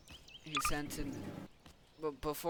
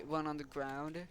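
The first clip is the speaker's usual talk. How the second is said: usual speaking voice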